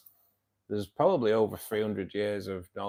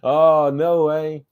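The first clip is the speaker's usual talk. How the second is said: surprised; 7 semitones higher, 14 dB louder, slower than usual. Real, not acted. Reacting to chat message.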